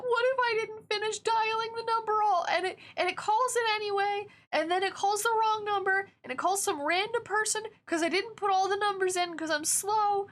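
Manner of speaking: put-on high, panicky anxious kid. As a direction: replay the childhood phone panic. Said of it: "I was so anxious about this thing".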